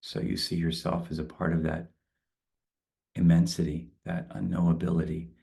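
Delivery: calm, spacious guided meditation. Acted. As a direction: ease them into stillness. Leads a closing meditation.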